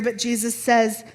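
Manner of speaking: usual speaking voice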